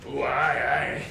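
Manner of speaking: bright forward Scottish oh aye; Scottish accent. Retold or imitated. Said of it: "Scottish people ... because we're like".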